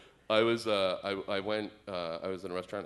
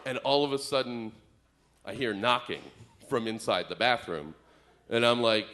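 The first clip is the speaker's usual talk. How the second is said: ordinary talk, nothing marked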